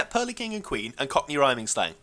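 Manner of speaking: ordinary talk, nothing marked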